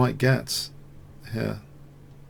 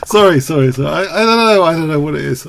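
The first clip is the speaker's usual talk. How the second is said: laughing apology; 3 semitones higher, 8 dB louder, faster than usual. Real, not acted. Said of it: "I don't know why I'm laughing".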